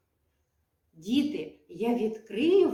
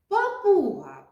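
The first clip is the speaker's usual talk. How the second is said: «папуга» stretched, higher and louder; drawn out; 9 semitones higher, 4 dB louder than usual. Shown as a demonstration. Teacher showing children how long a word sounds, drawn out. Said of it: "Як довго ми вимовляємо його".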